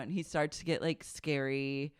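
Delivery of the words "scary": ordinary talk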